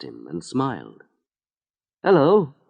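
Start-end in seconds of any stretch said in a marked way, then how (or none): 2.0-2.6 a touch higher, friendly greeting; a hint of a cheerful young mother; lightly voiced friendly woman. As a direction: greet him like a kind passer-by. Woman with baby greets Harry.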